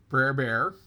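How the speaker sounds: usual speaking voice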